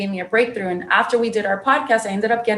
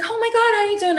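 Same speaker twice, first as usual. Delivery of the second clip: much higher, gushing imitation of a thrilled mother; excited mom; 11 semitones higher, faster than usual. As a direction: play mom bursting with joy at the call. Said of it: "She always gets excited when I call her".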